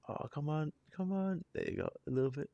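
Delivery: high, climbing plea; exasperated coaxing. Real, not acted. Reactor urging the camera to show goosebumps, exasperated coaxing. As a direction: coax it with rising, real frustration.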